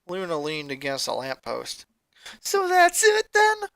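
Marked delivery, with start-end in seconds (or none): 2.4-3.7 high, slow, louder voice near tears; voice breaking. Acted. Sister pleads not to give up, voice breaking. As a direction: let the voice crack on the edge of crying. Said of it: "Her voice was on the edge of breaking".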